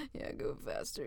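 dropped to an unvoiced whisper; whispered repeat. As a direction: echo it in a hush.

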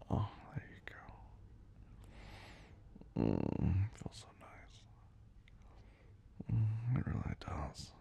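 soft, slow whisper. Real, not acted. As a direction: murmur it tenderly.

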